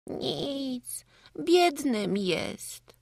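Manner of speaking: put-on high, slow small boy voice, tearful; little boy's voice, sad and drawn out; sad child. A narrator's reading. Small boy answering his mother in the cart, sad and slow.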